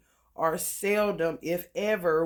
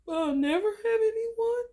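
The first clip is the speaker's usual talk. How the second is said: high, far-off mock whimper with a laugh; whiny mock lament. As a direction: whine like a clingy lover, half laughing. Mocking a needy partner.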